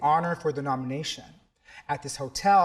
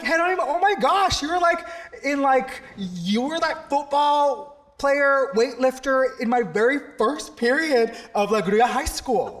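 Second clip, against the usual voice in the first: high, louder, thrilled disbelief; amazed recognition; 9 semitones higher, 4 dB louder than usual. Retold or imitated. Teacher recognises his old student, high and thrilled. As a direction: gush as it all comes back. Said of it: "And I'm like".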